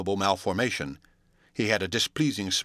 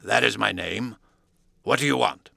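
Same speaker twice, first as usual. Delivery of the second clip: cool answer. A narrator's reading. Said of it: "he answered coolly enough".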